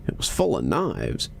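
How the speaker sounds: ordinary delivery, nothing marked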